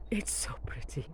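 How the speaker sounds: hushed whisper of delight; a whispered aside. Real, not acted. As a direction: breathe it out softly to yourself. Admiring clematis, hushed aside.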